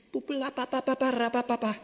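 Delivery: fast playful babbling sound; bubbling babble. Acted. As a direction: burble like water bubbling up.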